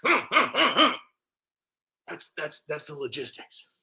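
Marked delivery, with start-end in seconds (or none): 0.0-1.0 out of breath after shouting; panting. Real, not acted. Vlogger out of breath after his rant, panting. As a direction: catch your breath in gasps.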